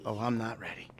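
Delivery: whispered aside, no voice. Real, not acted. [name] whispering doubtfully to the cult leader. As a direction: breathe it under your breath.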